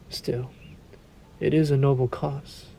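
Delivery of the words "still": whispered aside. Acted. Vampire muses.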